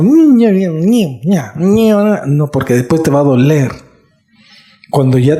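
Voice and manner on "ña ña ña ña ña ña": high, swooping nonsense whine; hammed-up childish griping; mock whining. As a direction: mimic a complainer with wordless nyah-nyah. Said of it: "no vayas a estar diciendo".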